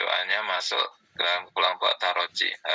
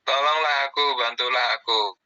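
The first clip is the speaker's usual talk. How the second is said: higher and louder, begging for help; loud plea for help; 5 semitones higher, 4 dB louder than usual. Acted. Teacher acting a man begging a friend for help, loud and high. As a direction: call out as if you need help now.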